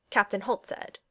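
plain reading voice

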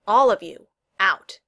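loud, firm command; ordering out. Acted. Girl orders friends from her room, commanding. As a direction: throw them out in few words.

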